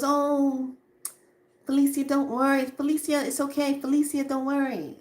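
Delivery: high, sing-song soothing of a worried friend; mock reassurance. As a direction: imitate a friend breezily saying not to fret. Friend brushes off her warning.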